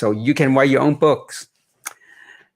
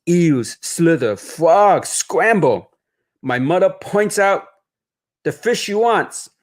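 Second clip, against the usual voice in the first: story narration; 2 semitones higher than usual. A narrator's reading. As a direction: read it gently like a bedtime story. Author reads picture book aloud.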